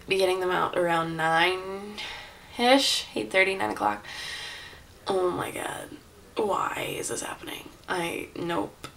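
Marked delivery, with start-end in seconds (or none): 5.1-8.9 nervous whisper to herself. Real, not acted. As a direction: breathe the words out, scared. Nervous before surgery, whispering.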